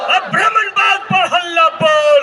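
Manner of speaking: shouted slogan. Acted. Leader rouses the rally crowd, shouted slogan chant. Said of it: "इतना जोर से हल्ला बोलना होगा".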